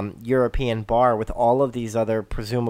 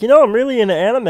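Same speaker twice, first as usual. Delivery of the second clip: quick, high, loud mock quote; throwaway punch-up line; 10 semitones higher, 7 dB louder, much faster than usual. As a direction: toss it off fast and high like a dubbed-in gag. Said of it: "it's a punch up line where it's just like".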